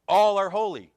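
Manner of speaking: high, loud declaration; loud proclaimed claim. Acted. Preacher quoting the rebels to the congregation, loud and high.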